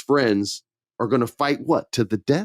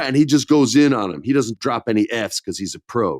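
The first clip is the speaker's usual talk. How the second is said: ordinary talk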